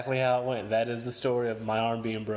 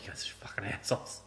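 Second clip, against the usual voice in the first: whispered curse, much softer; under-breath whisper; 4 semitones lower, 9 dB quieter than usual. Retold or imitated. Whispering at his parents from the back seat. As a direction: hiss it so the parents can't hear. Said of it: "holding back tears".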